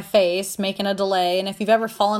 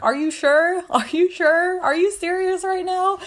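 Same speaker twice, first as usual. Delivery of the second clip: high, squeaky retold astonishment; acted tearful amazement; overjoyed disbelief; 10 semitones higher than usual. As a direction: ask it high, as if about to cry happily. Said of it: "I'm crying. I'm shaking".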